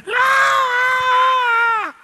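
put-on high shrill child's scream of no; screaming toddler. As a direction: shriek it like a defiant two-year-old.

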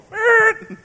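a sudden high laugh; real laugh at his own story; high burst of laughter. Real, not acted. Laughs after the story climax.

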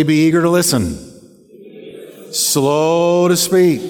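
slow, drawn-out recitation for the church to repeat. Shown as a demonstration. Pastor leading the church in a slow verse, unhurried. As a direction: draw each word out slowly. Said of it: "Say with me".

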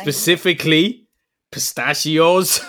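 "pistachios" drawn out syllable by syllable; teasing mispronunciation example. Shown as a demonstration. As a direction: slowly spell out each sound of "pistachios". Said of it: "on mispronunciation".

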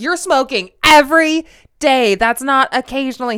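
loud, high accusing mock rant; indignant scolding. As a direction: scold him loudly, hammering it as a retold accusation. Acted honeymoon quarrel over smoking.

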